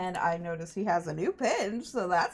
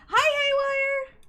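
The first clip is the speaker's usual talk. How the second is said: squeaky hello; 7 dB louder, slower than usual. Pretend. Greeting a friend joining stream.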